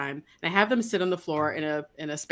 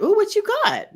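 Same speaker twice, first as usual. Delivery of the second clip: eager dog voice; 13 semitones higher, 4 dB louder than usual. Retold or imitated. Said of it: "they're like".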